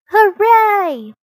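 high, loud cheer. Acted. Children celebrating the trick, loud cheer. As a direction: cheer out the win. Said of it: "said the children".

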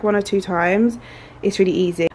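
usual speaking voice

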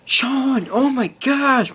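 high, slow, drawn-out angry cry at the brother; retold angry outburst. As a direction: shriek his name in slow disbelief. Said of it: "I was, like, swearing".

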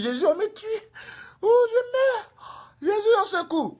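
very high, wailing panic; mock cry for help. Evangelist now crying to Jesus for help, hammed-up wail. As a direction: wail as if being killed. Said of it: "qui crie au secours".

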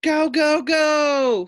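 high, loud rallying shouts; urgent calls. A narrator's reading. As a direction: urge the duck on at full voice. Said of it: "Fluffy called to his duck".

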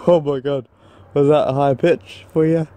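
ordinary talk, nothing marked

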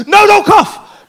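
high, loud sudden warning; urgent shout. Retold or imitated. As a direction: cry out to stop him at once. Guide stops him coughing.

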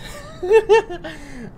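high, loud relieved laugh; shaky laugh after the scare; high shocked laugh. Real, not acted.

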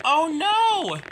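high, loud "oh no"; alarmed cry. Acted. Two engines hear the ghost and cry out, alarmed. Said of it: "the two cried".

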